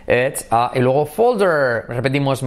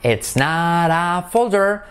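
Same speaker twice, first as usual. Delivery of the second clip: slow, high model of "It's not a folder"; slow careful denial; slow negative; 4 semitones higher, slower than usual. Shown as a demonstration. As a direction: say it slowly, clearly denying. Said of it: "que es una negativa".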